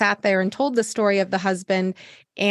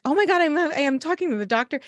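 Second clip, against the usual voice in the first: imitating a stunned caller, higher pitch; mock gasp of amazement, pitched up high; amazed disbelief; 6 semitones higher, faster than usual. Patients amazed to reach their doctor, mock astonished higher voice. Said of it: "people are like".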